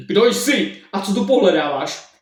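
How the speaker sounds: slow and loud, interrogating a stranger; stern question. Acted. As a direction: demand it like a suspicious innkeeper. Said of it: "přísným pohledem se zeptal".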